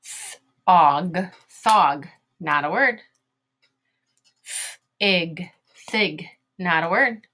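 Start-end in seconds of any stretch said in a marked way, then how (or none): 0.0-2.1 airy Th-, og, then blended thog; teacher blending thog from its sounds; sounding out. Shown as a demonstration. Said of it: "Here we go". 4.4-6.3 airy Th-, ig, then blended thig; teacher blending thig from its sounds; sounding out. Shown as a demonstration. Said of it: "Here we go".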